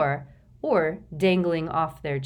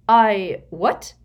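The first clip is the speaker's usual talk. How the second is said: stalling; 4 semitones higher, 10 dB louder than usual. A narrator's reading. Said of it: "plainly playing for time".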